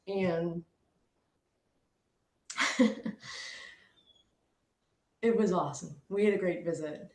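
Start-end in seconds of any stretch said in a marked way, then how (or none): 2.5-3.4 quiet airy chuckle after a pause; soft breathy laugh. Real, not acted. Remembering the nail polish promise, quiet laugh. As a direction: chuckle softly to yourself.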